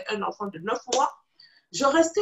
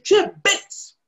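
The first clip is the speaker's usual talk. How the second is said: friend's taunt; 8 semitones higher, 5 dB louder, much slower than usual. Retold or imitated. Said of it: "elle me dit".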